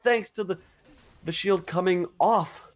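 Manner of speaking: breathless after a hype call; gasping for air between words; out of breath. Real, not acted. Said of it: "let me catch my breath".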